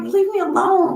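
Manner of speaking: high, exasperated leave me alone; high annoyed brush-off; irritated. Retold or imitated. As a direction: snap it like you've had enough. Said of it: "I'm just like".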